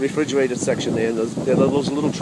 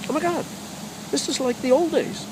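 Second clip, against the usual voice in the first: much higher, amazed gasp of delight; awed exclamation; 13 semitones higher than usual. Retold or imitated. Speaker marvelling at the woman's tuna tin, high awe.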